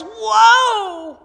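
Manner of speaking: loud, high yell to halt a horse. Retold or imitated. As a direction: shout whoa at a runaway horse. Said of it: "And he yells".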